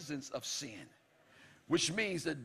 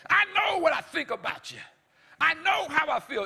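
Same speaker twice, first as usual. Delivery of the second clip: loud, high indignant declaration; God declaring it with indignation; 9 semitones higher, 8 dB louder than usual. Acted. Preacher voicing God, shouting with indignation. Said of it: "God can say it indignant".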